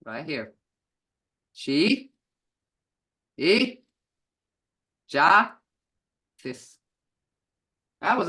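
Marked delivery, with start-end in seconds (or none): 1.5-6.8 each syllable said apart, loud. Shown as a demonstration. Teacher sounding out a word for the class, slow and syllable by syllable. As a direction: sound it out slowly, one piece at a time. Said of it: "Look here".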